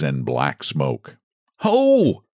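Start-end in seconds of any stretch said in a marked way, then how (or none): 1.6-2.2 sharp high cry of alarm; a sudden cry of fright, pitched high; mock startled shout. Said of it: "cried the little man".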